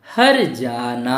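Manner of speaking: हर जाना slow: har, then long jaa-naa; r before jaa. Shown as a demonstration. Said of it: "जा से पहले करना है". (हर जाना में र को जा से पहले, धीरे बोलें)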